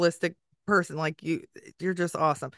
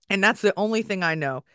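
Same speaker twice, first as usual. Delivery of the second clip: normal talking voice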